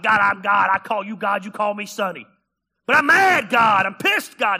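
loud shouting rage at God; throwing down with God. Acted. Preacher in his mom's attic fighting with God, shouting. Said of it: "he's throwing down with God".

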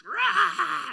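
high, loud frustrated roar; venting scream alone in the car. Retold or imitated. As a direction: let it all out in one loud roar.

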